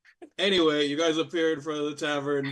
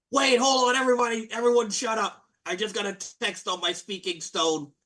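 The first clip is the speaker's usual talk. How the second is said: brash, higher mock-tragic announcement; Blue Blairy; 5 semitones higher than usual. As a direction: cut everyone off to share the news. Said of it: "who goes like".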